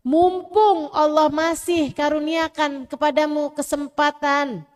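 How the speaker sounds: loud, slow, rising sermon cry; fiery preaching. Acted. Preacher urging listeners to repent, loud and slow. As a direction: preach it hard with full force.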